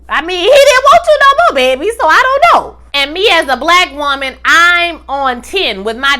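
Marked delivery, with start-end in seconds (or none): 0.1-2.8 high, loud, sassy jab; heated sass. Real, not acted. Vlogger tells the ex-wife he didn't want her, high and loud. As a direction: rise up and sting her with it.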